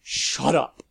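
snarled. A narrator's reading. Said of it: "Lavinia snarled".

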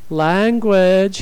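high, loud mock scolding; teasing singsong reproof. A narrator's reading. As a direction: playfully tell her off. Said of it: "he teased".

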